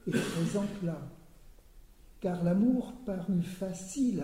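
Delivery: measured poetic recitation; poem reading. Acted. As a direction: declaim the translated ghazal line by line. Reads poem translation.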